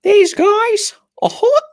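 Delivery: very high, loud comic character voice; Edward voice. Acted. Introducing the villagers as Edward, high loud put-on voice. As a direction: squeal it out loudly as a silly villager. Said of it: "In Edward's voice".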